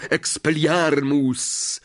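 high, drawn-out shouted incantation; roared spell. A narrator's reading. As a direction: cry the spell out, stretching it. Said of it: "Harry ryknął".

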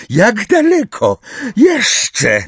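high, loud, drawn-out demand; angry. A narrator's reading. Umbridge calls to them from behind, angry. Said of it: "rozzłoszczony głos Umbridge".

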